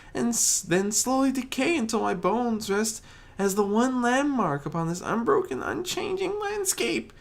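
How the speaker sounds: high, quick, rising panic; anxious Fluttershy. Acted. Fluttershy fears wandering forever, rambling in fast anxious worry. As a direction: spiral into worry.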